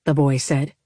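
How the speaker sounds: ordinary delivery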